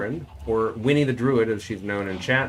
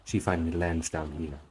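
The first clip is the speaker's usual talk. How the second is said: musical Eastern European cadence, held up until the last phrase; Lethica; 4 semitones higher than usual. Shown as a demonstration. Streamer showing the character's cadence, Eastern European accent. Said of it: "sort of an Eastern European accent".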